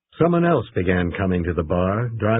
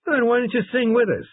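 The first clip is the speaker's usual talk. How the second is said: much higher, insistent ask; pressing question. A narrator's reading. Crew pressing Sugar to sing with them, insistent. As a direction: push him to join in.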